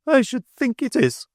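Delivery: high exultant cry; exulting. A narrator's reading. Mrs Sparsit to Rachel, high exultant cry.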